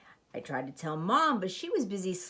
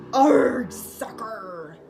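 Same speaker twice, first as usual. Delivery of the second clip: louder pirate growl; pirate yell; 3 semitones higher, 8 dB louder, much slower than usual. Acted. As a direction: kick and roar it like Braidbeard. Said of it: "kicked the ball and yelled".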